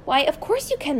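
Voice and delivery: high-pitched, delighted consent; eager cry. A narrator's reading. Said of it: "Missus Silver cried".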